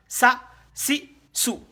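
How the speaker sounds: "Sa", "si", "sou" each short and separate; short, spaced syllables "Sa, si, sou"; brief vowels. Shown as a demonstration. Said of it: "C'est bref, non".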